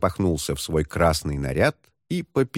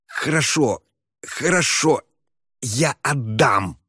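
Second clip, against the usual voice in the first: higher, pained, slow hissing; hissed; 5 semitones higher, slower than usual. Acted. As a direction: hiss it through the pain. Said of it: "прошипел он".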